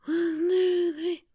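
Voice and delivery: high whispered counting. Shown as a demonstration. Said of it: "breathe in for three and out for three".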